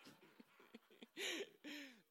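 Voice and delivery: high burst of embarrassed laughter. Real, not acted. Laugh after forgetting a name.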